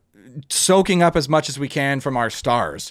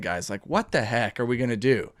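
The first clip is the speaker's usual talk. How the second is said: low and much softer, deflated; disappointed, quiet; 7 semitones lower, 8 dB quieter than usual. Real, not acted. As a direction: sigh it out, let down. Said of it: "I just get so disappointed".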